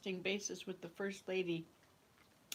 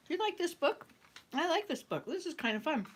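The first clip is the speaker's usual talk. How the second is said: excited; 8 semitones higher than usual. Real, not acted. Delighted aside about the book.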